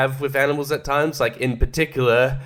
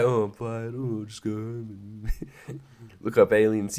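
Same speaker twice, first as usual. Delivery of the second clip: apathetic shrug; 4 semitones lower, 7 dB quieter than usual. Retold or imitated. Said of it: "You're just like".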